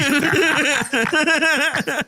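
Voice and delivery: long, high-pitched laugh; high cackling laugh. Real, not acted. Laughing at own punchline.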